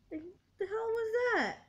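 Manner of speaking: real tearful outburst at the father; crying disbelief. Real, not acted. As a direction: cry it out in disbelief. Crying over father's brief visit.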